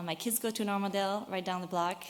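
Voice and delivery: ordinary talk, nothing marked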